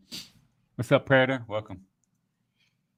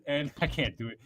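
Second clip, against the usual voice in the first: ordinary talk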